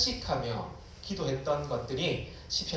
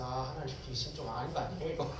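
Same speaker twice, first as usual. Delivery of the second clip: low, much softer aside to God; grumbled prayer; 3 semitones lower, 10 dB quieter, faster than usual. Acted. Young believer grumbling to God while praying, low murmur. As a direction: complain under your breath, as if praying. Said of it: "기도하면서".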